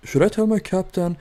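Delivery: high, clueless mock radio question; imitating radio operator. Operator asking the sinking ship, mock clueless. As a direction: ask like a useless operator. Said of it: "They were like".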